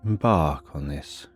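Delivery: ordinary delivery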